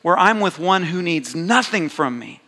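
rising, emphatic preaching of being chosen; impassioned sermon voice; heartfelt sermon. Real, not acted. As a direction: preach it with swelling conviction. Emotional opening testimony.

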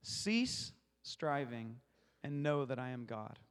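Cease drawn out slowly and set apart, the rest easing down; stress on Cease. Shown as a demonstration. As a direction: lean long and slow on "Cease". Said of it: "I'm gonna emphasize the first word".

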